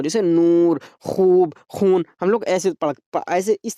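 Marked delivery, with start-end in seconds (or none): 0.2-2.1 नूर, खूब, खून with the oo held long; drawn-out vowels. Shown as a demonstration. Teacher showing how maroof is read, नूर, खूब, खून drawn out. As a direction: stretch each oo slowly in नूर, खूब, खून. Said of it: "जिस चीज़ को खींच कर पढ़ा जा रहा हो".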